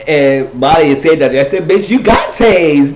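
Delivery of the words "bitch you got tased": loud, high and drawn out; gleeful mock shout. Reteller telling the high friend she got tased, gleeful mock shout. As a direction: stretch it out loud, on the edge of laughter.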